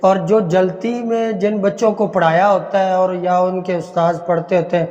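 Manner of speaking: usual speaking voice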